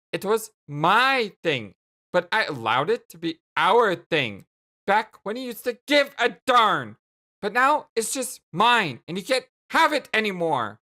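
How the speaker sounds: loud, possessive protest; indignant insistence. Acted. Jane taking back the shared catchphrase, indignant. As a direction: stress each claim of ownership angrily.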